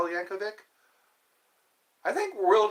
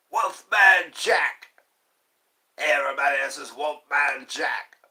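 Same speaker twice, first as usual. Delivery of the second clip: Wolfman Jack radio voice; 7 dB louder than usual. Retold or imitated. Vlogger imitating the radio DJ, booming announcer call. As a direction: bellow it like a fifties radio DJ. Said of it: "called Wolfman Jack".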